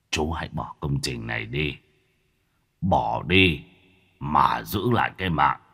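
put-on low, grave voice of an old master; old master's warning. A narrator's reading. Old master Thức warning Khôi to quit, low and grave. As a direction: warn him gravely, deep and low. Teacher Thức's warning recalled.

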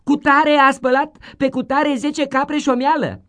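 put-on high, loud child telling tales; loud tattletale kid; tattling child. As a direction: snitch eagerly to the teacher. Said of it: "începeau".

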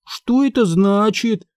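put-on high, slow child's question; lightly voiced child, pitched high; little sister. A narrator's reading. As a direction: ask it like a puzzled little girl. Little sister asking, voiced in story.

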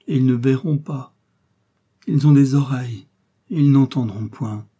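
unspeakable sorrow. A narrator's reading. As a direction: press the pain out loudly. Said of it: "avec un accent d'indicible douleur".

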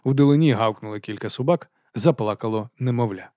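ordinary delivery, nothing marked

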